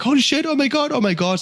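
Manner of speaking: high, panicked bystander exclamation; retold screaming. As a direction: mimic onlookers shrieking without full volume. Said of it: "they were, like, screaming".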